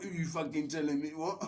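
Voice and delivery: high-pitched mock indignation; feisty. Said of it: "only time she ever gets feisty".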